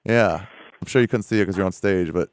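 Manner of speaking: normal talking voice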